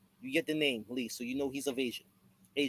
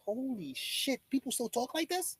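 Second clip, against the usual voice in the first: shocked disbelief; 8 semitones higher, 3 dB quieter, slower than usual. Retold or imitated. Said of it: "I'm like".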